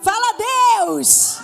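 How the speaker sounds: loud, high "Fala" call of a Pentecostal believer; Pentecostal shout. Shown as a demonstration. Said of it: "Crente pentecostal é assim". (grito de crente pentecostal)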